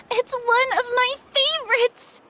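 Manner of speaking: thrilled to spot a favorite dessert; squealing recognition of a treat; delighted. Acted. Delighted at a surprise gift.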